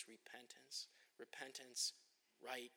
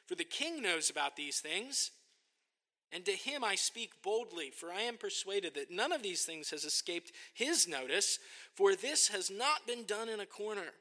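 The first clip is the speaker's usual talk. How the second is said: bold; 6 semitones higher, 8 dB louder than usual. Retold or imitated. Paul speaking boldly to Agrippa.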